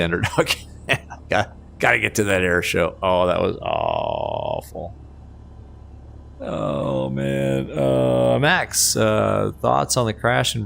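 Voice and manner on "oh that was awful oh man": mock gloom. Mock gloom over crash landing.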